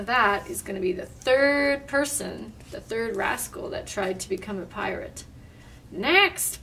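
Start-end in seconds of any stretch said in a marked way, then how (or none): 5.9-6.6 high-pitched parrot call; blaring parrot. A narrator's reading. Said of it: "blared the parrot".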